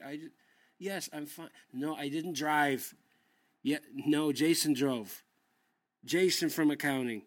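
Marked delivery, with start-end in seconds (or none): 1.7-7.2 louder, slow and emphatic; louder defensive insisting. Acted. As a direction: insist hard, spelling it out. Arguing with ex.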